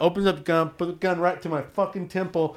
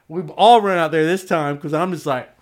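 normal talking voice

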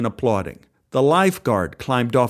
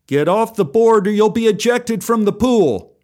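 shouted threat; 8 semitones higher, 4 dB louder than usual. Acted. Lifeguard shouting threat at boy.

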